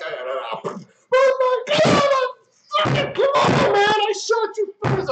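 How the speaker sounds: shrill, loud, slow rage at being shot; furious gamer yell. Retold or imitated. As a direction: scream at the screen like you just got killed. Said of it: "I'm pissed off and I'm yelling".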